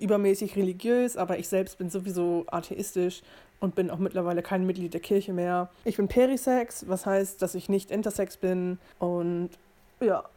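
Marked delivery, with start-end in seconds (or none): none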